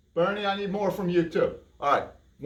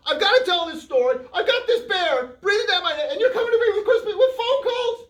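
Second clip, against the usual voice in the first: laughing exasperation; 11 semitones higher than usual. Acted. Host exasperated by interruption.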